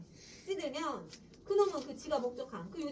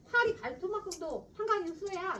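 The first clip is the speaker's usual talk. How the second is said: put-on higher, louder old man in dialect; gruff grandfather; 8 semitones higher, 4 dB louder than usual. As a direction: wave it off like a crude old man. Same quote in standard words.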